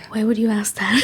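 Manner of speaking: whispered imitation of disbelief; mock whisper. Sister questioning a tactless remark, in a whisper. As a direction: whisper it like a silent reproach. Sister's silent look voiced as words.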